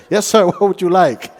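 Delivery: vendor greeting. Retold or imitated. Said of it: "only when I got there".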